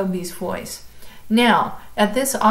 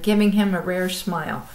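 ordinary delivery, nothing marked

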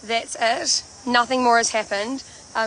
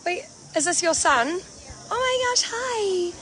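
delighted; 12 semitones higher, 4 dB quieter, slower than usual. Real, not acted. Greets the singer's little son.

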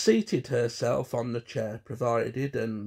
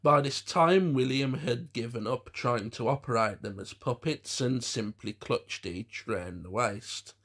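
ordinary delivery

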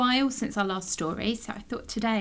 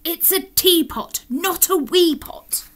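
plain reading voice

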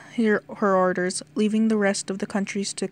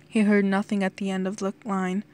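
ordinary delivery

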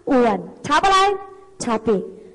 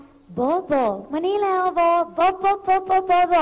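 high, slow, playful calling; cute pet-name calling; 6 semitones higher than usual. Acted. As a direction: call Bo over in a cutesy high voice. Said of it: "เราสามารถเรียกเขาน่ารักน่ารัก". (เรียกเพื่อนเสียงสูงแบบน่ารักๆ)

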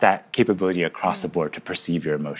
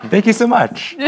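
laughing; 12 semitones higher than usual. Real, not acted. Laughs while thanking.

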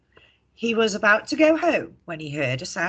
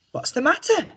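George asking; 10 semitones higher than usual. A narrator's reading. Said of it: "asked George".

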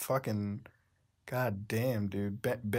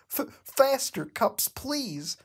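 pleading Bendy; 10 semitones higher, much slower than usual. Acted. Reader voicing Bendy begging Cuphead, high pleading. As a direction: beg in a squeaky cartoon voice. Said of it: "panted out pleadingly".

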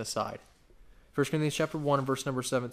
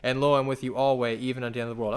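scripture reading; 5 dB louder than usual. Acted. Preacher reading scripture to the congregation, solemn. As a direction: read the commission with solemn weight.

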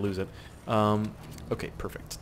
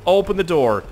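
urgent yell; 7 semitones higher, 9 dB louder than usual. Real, not acted. Urgent yelling.

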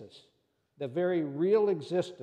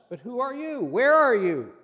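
startled question; 7 semitones higher, 8 dB louder than usual. Acted. Jack hears unseen voice.

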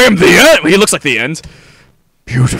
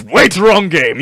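usual speaking voice

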